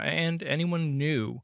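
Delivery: ordinary talk, nothing marked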